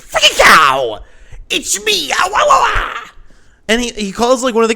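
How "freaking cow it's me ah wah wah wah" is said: high-pitched, shouted nonsense outburst. Retold or imitated. Reviewer mocking the actor's made-up words, hammed-up shouting. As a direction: yell it in a wild, over-the-top celebrity imitation.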